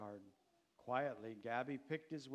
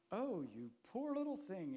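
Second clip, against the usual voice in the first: high, swooping sympathetic cry; pitying exclamation; 8 semitones higher, 4 dB louder than usual. A narrator's reading. Farmer's wife noticing the boy's worn-out clothes, pitying exclamation. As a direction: exclaim with pity. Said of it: "exclaimed the farmer's wife".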